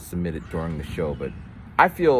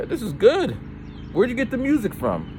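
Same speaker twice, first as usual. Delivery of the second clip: high-pitched imitation of an approving father; high mock-dad voice; impressed dad. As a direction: play dad pleasantly surprised, pitch way up.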